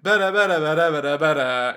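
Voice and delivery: loud chanted better better; mouthed dial-up chant. Retold or imitated. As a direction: chant it like a modem noise. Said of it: "what's the other bit?".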